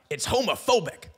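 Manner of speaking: heckler yelling. Retold or imitated. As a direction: yell the accusation from the crowd. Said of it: "the guy in the audience yells out".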